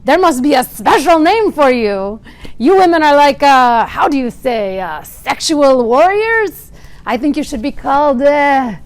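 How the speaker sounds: loud, slow, hammed-up French accent.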